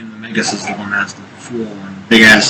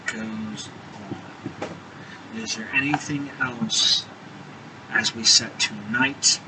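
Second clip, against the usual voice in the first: quiet wrap-up; 3 semitones lower, 14 dB quieter than usual. Real, not acted. Game master winding down session.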